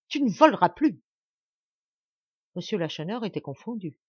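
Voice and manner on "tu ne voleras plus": angry threat. Acted. Threatening the thief Chupin.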